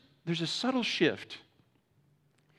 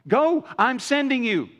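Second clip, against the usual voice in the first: encouraging hype; 7 semitones higher, 5 dB louder, slower than usual. Acted. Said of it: "the Lord comes back and says".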